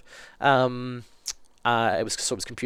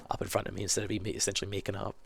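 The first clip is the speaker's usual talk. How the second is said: quiet; 2 semitones lower, 8 dB quieter, faster than usual. Real, not acted. Embarrassed aside.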